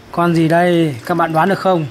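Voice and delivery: normal talking voice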